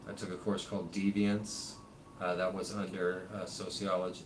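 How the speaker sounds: ordinary talk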